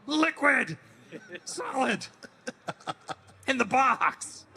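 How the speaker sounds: playful high voices; character voice. Pretend. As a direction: toss off the names in character. Fan panel character voices.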